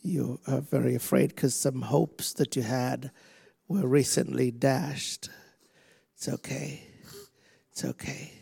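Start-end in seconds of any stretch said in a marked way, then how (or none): 6.1-8.3 quiet, gentle comfort; soft reassurance. Real, not acted. Preacher comforting a trembling woman, soft and gentle. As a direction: hush her fears softly.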